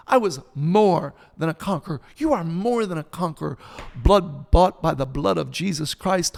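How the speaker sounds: loud, emphatic, rising declarations; full-force preaching. Real, not acted. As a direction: hammer each phrase like a preacher.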